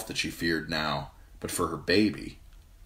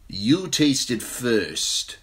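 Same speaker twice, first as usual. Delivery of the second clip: Jorah, suspicious; 6 semitones higher, 4 dB louder, much slower than usual. A narrator's reading. Jorah refusing the cup.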